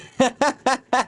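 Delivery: bright high giggling laugh. Real, not acted. Streamer laughing at a viewer's comeback, high giggle.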